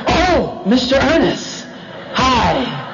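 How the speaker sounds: high, drawn-out put-on sweetness; hammed-up change of tone on the phone; mock phone answerer. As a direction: imitate a suddenly gushing greeting, sinking on the last word. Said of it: "how you answer the phone".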